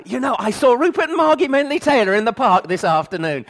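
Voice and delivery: a light send-up of the Queen chatting; mock Queen. The Queen chatting to Prince Philip, mock regal voice. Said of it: "the Queen did not go home and say".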